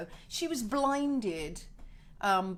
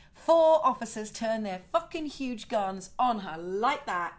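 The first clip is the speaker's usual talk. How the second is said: normal talking voice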